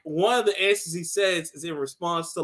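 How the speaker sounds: normal talking voice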